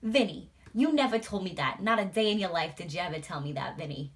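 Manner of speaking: hammed-up older New York woman; New York wife scolding. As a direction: playfully nag Vinny. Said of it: "she was like".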